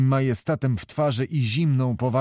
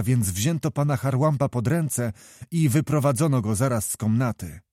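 ordinary delivery, nothing marked

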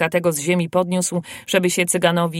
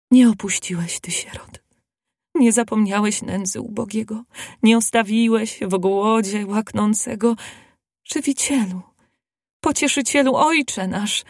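higher, plaintive praying woman; tearful prayer; 4 semitones higher than usual. A narrator's reading. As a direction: pray with tender, tearful feeling. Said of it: "modlić się zaczęła rzewnym głosem". (rzewna modlitwa)

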